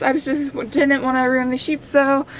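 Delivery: ordinary talk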